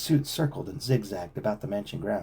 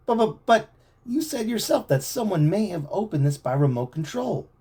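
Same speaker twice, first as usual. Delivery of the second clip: stammering, higher frightened voice; shaky; 10 semitones higher, 3 dB louder than usual. A narrator's reading. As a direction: stumble over the words scared. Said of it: "Chet said shakily".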